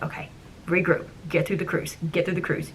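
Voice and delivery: softly coaching herself through it; muttered pep talk under the breath; quiet self-talk. Retold or imitated. Skipper quietly telling herself to get through the cruise.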